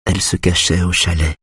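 usual speaking voice

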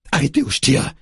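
high-pitched sharp threat, moderate volume; yelled warning. A narrator's reading. Parissa warning the old man off with a gun, high yell. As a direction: cry a warning, high and urgent. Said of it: "hurle-t-elle".

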